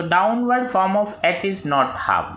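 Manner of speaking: reads the exam question very slowly, word by word; slow dictation reading. Acted. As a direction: dictate it so students can write. (प्रश्न धीरे-धीरे बोलकर लिखवाना)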